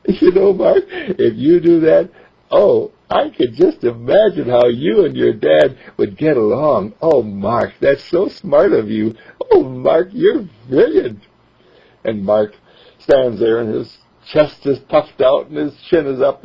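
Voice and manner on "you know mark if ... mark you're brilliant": higher, delighted praising mother; proud praise. Acted. Mother praising her son, delighted and proud. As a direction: gush over how smart he is.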